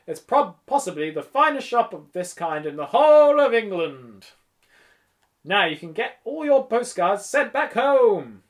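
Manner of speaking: theatrical, hearty boasting; boastful shopkeeper. A narrator's reading. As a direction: show off the shop grandly. Voices the shopkeeper.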